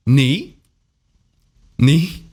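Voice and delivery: 你 sliding upward in pitch; second tone. Shown as a demonstration. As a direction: say 你 short, with a rising tone. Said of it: "has to turn into the second tone".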